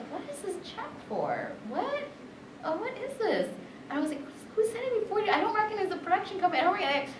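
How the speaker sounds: high, puzzled and fast; baffled high voice. Retold or imitated. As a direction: squeak out the confusion. Retold puzzled check reaction.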